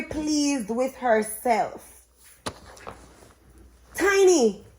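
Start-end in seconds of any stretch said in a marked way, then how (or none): 3.9-4.6 a high, louder cry of the name; calling out. A narrator's reading. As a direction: call the cat's name out high. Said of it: "called, cried Princess Faye".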